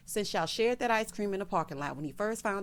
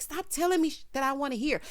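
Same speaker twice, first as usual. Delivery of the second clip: high-pitched acted protest; upset retort; 7 semitones higher than usual. Imitating Karen telling Aaron to stop, upset retort. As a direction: snap it higher, imitating someone upset. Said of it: "She gets upset".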